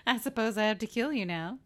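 absurdly put-upon, pushed up in pitch; escalating grudging offer. Retold or imitated. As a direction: cap the escalation with a comic sigh of duty. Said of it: "how she keeps escalating".

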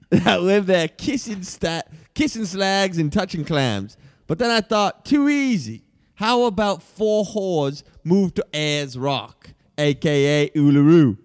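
broad mock Aussie pitchman; Australian accent. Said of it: "I'm from Australia".

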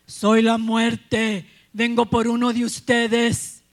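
booming, slow voice of Death; loud and drawn-out, like doom calling; the old couple hears Death at the door, slow and loud. Acted.

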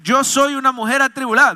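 emphatic, high-pitched retelling; impassioned protest. Retold or imitated. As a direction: plead your case in a preacher's declamation. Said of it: "Ana respondió diciendo".

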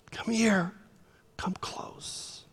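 unvoiced hushed beckoning; whisper. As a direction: call them near in a whisper. Joseph beckoning brothers softly.

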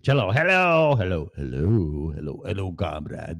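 lightly put-on Russian accent greeting; mock Russian comrade. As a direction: call out hello like a hammy Russian comrade.

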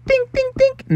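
high little 'ding' taps; imitated knocking. A narrator's reading. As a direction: tap out knocks with the voice. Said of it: "used his other hand to go".

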